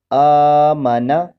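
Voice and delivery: "آ" held for two beats, "مانا" short; long-vowel demo. Shown as a demonstration. Teacher shows viewers the standing zabar, stretched "آ". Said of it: "دو حرکتوں کے برابر کھینچا".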